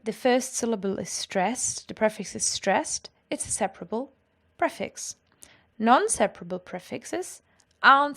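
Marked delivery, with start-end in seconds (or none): none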